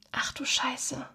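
tonlos und leiser geflüstert; Flüstern. A narrator's reading. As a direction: erschrocken hauchen, ohne Stimme. Schaulustige sehen den Zusammenbruch. (ein Schaulustiger flüstert erschrocken über den Zusammenbruch)

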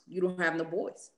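usual speaking voice

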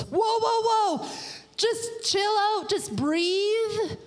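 high, drawn-out plea for calm; Moses. Acted. Moses settles the panicking Israelites, high urgent calming. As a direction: rein in a panicking crowd, pitched right up. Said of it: "I can just imagine Moses".

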